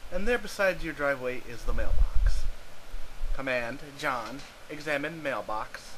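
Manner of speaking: deadpan game narrator; story narration. A narrator's reading. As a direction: narrate the game with dry mock grandeur. Narrates the adventure.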